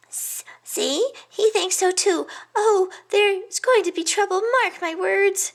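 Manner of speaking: a nervous little hedgehog listing past disasters; fretful little hedgehog. A narrator's reading. Hedgehog friend warning the pig about his decisions, high fretful voice.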